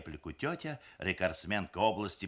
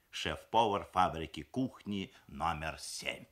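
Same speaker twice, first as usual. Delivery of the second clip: ordinary delivery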